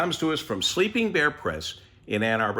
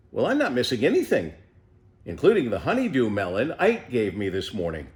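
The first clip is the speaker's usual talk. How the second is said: ordinary delivery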